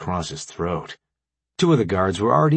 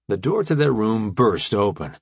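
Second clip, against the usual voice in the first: ordinary delivery, nothing marked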